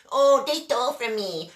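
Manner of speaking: a mocking whine aping complainers; mock whining. Mimicking whining distributors who lost money, mock whine. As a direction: whine like a sore loser. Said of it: "they're whining".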